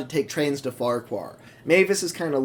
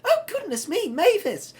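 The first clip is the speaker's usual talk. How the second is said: much higher, cheering; enthusiastic Percy; 13 semitones higher, 4 dB louder than usual. Acted. Percy cheering Mavis from the loop, high and excited. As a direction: cheer her on at the top of the voice. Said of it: "he's just so enthusiastic".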